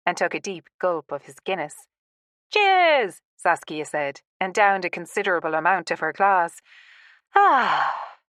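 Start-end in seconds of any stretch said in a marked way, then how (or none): 2.5-3.2 high, loud, drawn-out cheer; hearty toast. A narrator's reading. Woman toasting her friends in the pub, hearty. 7.4-8.2 long falling "ah" of relief; satisfied sigh. A narrator's reading. Woman after a big gulp of her drink, satisfied sigh. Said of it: "she sighed when she had swallowed".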